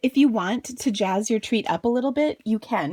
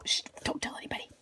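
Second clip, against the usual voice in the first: whispering; 10 dB quieter, faster than usual. Real, not acted. Secret about a missed line.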